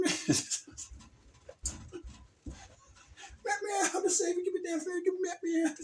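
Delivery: high squeaky kitty voice; cat voice. Acted. Voicing the crucified toy cat, high whine. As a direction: whine like a little trapped cat. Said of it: "Crucifixion Kitty".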